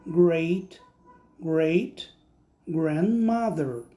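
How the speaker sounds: slow careful "great great grandmother"; English word. Shown as a demonstration. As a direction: say "great great grandmother" slowly and clearly. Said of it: "eu vou falar". (pronúncia lenta de "great great grandmother")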